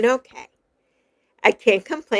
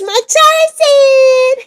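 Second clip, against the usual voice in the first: high drawn-out greeting; 12 semitones higher, 5 dB louder, much slower than usual. Pretend. Greeting gift in Tarzan voice.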